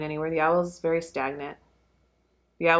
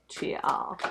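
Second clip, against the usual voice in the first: whisper; 6 dB quieter than usual. Real, not acted. Tarot reader trailing to viewers.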